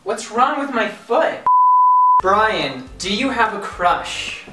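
usual speaking voice

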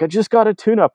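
high-pitched mimicry of a friend freaking out; imitated upset protest. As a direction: protest in a mock-distressed high voice. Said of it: "Alexi is freaking out. He's pretty upset".

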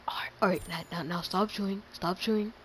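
whispered order to a friend. Real, not acted. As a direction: hiss it under your breath so the mic won't catch it. Hushed order during recording.

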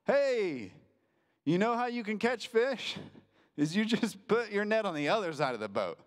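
high, calling-out stranger; mock yell from shore. Stranger on the shore calling to fishermen, mild mock yell. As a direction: call across the water, lightly hammed. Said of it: "yells from the shore".

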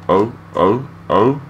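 normal talking voice

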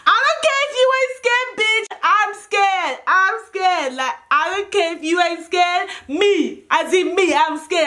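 high-pitched, worked-up retort; insistent scared protest. Retold or imitated. Arguing back at friends about the police, scared and insistent.

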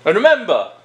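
ordinary talk

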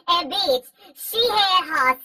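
normal talking voice

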